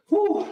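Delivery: high whooping exhale; effort whoop. Real, not acted. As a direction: let out a high woo. Exhale after set.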